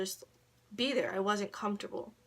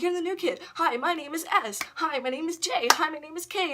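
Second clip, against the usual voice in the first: put-on high, loud eager kids' greetings; high bubbly hellos, a touch louder; 8 semitones higher, 6 dB louder than usual. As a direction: imitate a crowd of kids rushing to say hi. Kids greeting the new kid.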